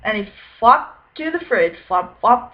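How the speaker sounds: plain reading voice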